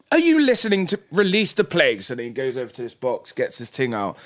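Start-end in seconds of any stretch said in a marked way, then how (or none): 0.1-2.0 high, loud exasperated God; impatient scolding. Retold or imitated. God scolds Jeffrey for not obeying, impatient.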